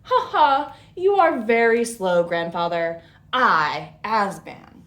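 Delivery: loud, cocky mock laughter at the rock; loud taunting. A narrator's reading. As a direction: show off and jeer at him. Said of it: "turning around and taunting".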